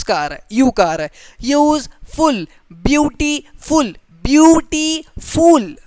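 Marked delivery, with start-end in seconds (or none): 1.4-5.8 यूजफुल and ब्यूटीफुल stretched slowly, each syllable sounded apart, higher pitch; syllables drawn out. Shown as a demonstration. Teacher counting vowel sounds in ब्यूटीफुल for students, slow and stretched. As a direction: sound out ब्यूटीफुल byoo-ti-ful slowly for the class. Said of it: "तीन vowel sound आ रहे हैं".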